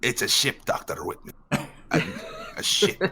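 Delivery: put-on quoting Grim with a light Scottish accent; a hint of a Scottish brogue, half-whispered in spots; light imitation of a Scottish accent. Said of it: "I just love his, uh, little quips too".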